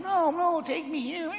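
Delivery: high-pitched whiny mock pleading; whining youngster. Uncool young hero begging to come along, high whiny voice. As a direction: squeak it out like a pestering kid.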